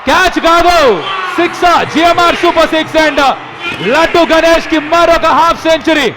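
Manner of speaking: high, loud six call; excited cricket commentary. Real, not acted. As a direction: call the six at full volume. Six called live, high pitch.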